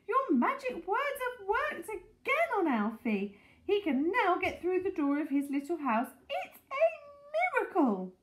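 high-pitched, jubilant exclaiming; excited crying out. A narrator's reading. Mrs Silver hailing Alfie's miracle, crying out. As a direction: marvel aloud, rising to a high peak. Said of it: "she was crying".

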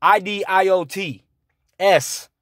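I D I O T S spelled letter by letter; each letter of I D I O T S said separately. Shown as a demonstration. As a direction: spell out I D I O T S slowly for emphasis. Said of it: "You are idiots".

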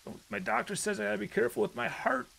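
high-pitched put-on frail voice; mock pleading. Joking plea after scare.

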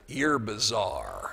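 slow, drawn-out low delivery; deadpan verdict. Retold or imitated. Man looks at the speaker to judge him, slow deadpan.

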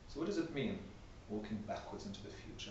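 questioning in a hush; hushed musing. Shown as a demonstration. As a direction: drop to a near whisper, wondering. Rhetorical musing, dropping to whisper.

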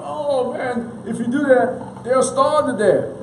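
high-pitched mock alarm, drawn out at the end; mock freaking out. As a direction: imitate people panicking over nothing. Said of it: "people just freaking out".

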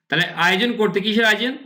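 ordinary delivery

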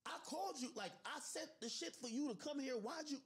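retold disbelieving high-pitched complaint; exasperated retort. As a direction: call him out in disbelief. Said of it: "I said".

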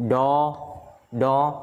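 "Do" drawn out by itself, slowly; first syllable alone. Shown as a demonstration. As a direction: hold "Do" as a separate teaching syllable. Teacher isolates first syllable of dollar.

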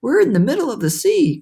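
put-on high, alarmed boy's exclamation; storybook cry of alarm. A narrator's reading. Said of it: "cried James".

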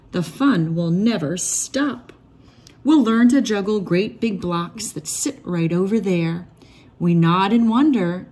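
ordinary delivery

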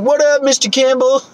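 put-on high, cheery kid greeting; squeaky excited little kid. Kids greeting him.

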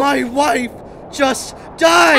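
anguished yell. Acted. Widower screaming at the man lecturing him, anguished yell.

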